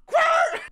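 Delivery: high-pitched mouth-made tyre screech. Retold or imitated. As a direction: imitate a car skidding round. Imitates tyres as car flips round.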